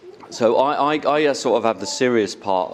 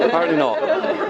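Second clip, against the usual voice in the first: amused; 12 semitones higher than usual. Real, not acted. Repeats for laugh.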